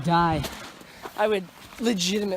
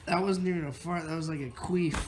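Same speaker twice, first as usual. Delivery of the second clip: normal talking voice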